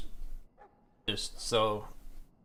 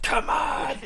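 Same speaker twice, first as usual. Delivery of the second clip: high hammed-up taunt; mock taunt. Player shoving Loghain toward the fire, high hammed-up taunt. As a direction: goad him into a fight.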